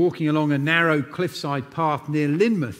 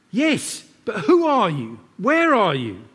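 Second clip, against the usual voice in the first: anxious questions; 7 semitones higher, 4 dB louder than usual. A narrator's reading. Jack questions the voice.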